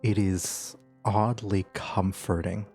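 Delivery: slow, hushed scene narration; game master's scene setting. Acted. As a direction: draw them into a dreamlike scene. GM sets the dream scene.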